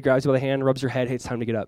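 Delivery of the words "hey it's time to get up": low, soft wake-up voice; gentle mom waking child. Retold or imitated. Mom waking her kid by hand, softly tender. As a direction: rouse a sleeping kid gently. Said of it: "When your mom walks in the room".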